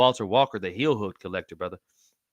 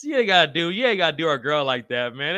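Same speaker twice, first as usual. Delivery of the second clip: ordinary talk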